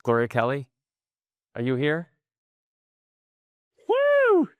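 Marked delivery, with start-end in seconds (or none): 3.9-4.5 high cheering whoop; excited woo. Real, not acted. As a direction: cheer for the attendee. Cheering a found attendee.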